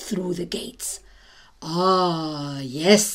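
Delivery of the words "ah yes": lower, hushed satisfied purr of a voice; cat musing. Acted. Cat peeping through the palace doorway, low and pleased. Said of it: "said the cat to itself".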